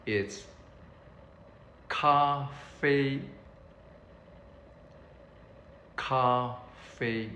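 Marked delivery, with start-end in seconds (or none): 1.9-7.3 咖啡 split into two long, level syllables; slow model pronunciation. Shown as a demonstration. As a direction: hold each syllable of 咖啡 evenly. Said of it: "for coffee, it's".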